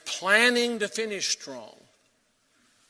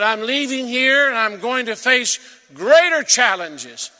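much louder and higher, slow, ringing declaration; full-force preaching shout; 8 semitones higher, 14 dB louder than usual. Acted. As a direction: proclaim Paul's words at full force, slowly. Preacher voicing Paul's bold declaration.

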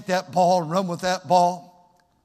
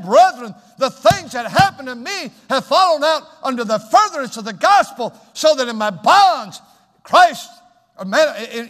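higher, louder fiery preaching; full-force preaching; 8 semitones higher, 9 dB louder than usual. Real, not acted. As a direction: preach it with fire.

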